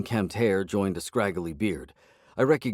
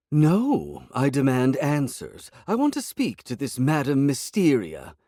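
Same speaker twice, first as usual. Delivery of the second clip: higher, louder royal demand; imperious cat; 7 semitones higher, 5 dB louder, slower than usual. A narrator's reading. Cat demands to see the fortune teller, loud and imperious. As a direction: insist like an offended queen.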